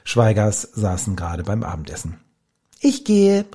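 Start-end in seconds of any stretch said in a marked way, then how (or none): 2.8-3.5 high, loud call; eifriges Rufen. A narrator's reading. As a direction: call it out high and quick to get there first. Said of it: "rief Nina und sprang auf". (hoch und laut gerufen)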